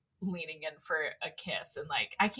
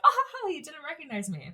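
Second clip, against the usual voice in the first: higher, delighted retelling of the line; tickled she got away with it; a girl gleeful at going unrecognized; 8 semitones higher than usual. Retold or imitated. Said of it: "she's having a blast 'cause she's like".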